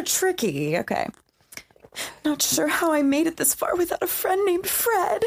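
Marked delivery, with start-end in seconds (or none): 2.2-5.2 high scared voice; pitched-up fearful, a little trembly. Acted. Mock scared, reading the game card. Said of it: "It was scared".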